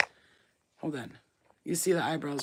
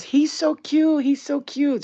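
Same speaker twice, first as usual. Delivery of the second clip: high, loud gushing mimicry; exaggerated drawn-out cooing; imitated admirers; 8 semitones higher, 6 dB louder, slower than usual. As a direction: imitate people fawning over a baby. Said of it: "Everybody always goes".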